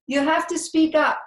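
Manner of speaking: high, loud scolding; a stern librarian raising her voice; loud stern order. A narrator's reading. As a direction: snap at her to speak up. Librarian demands loudness.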